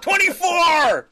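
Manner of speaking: high, drawn-out loud holler; yelling. Retold or imitated. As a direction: stretch the last yell long and high. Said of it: "starts yelling".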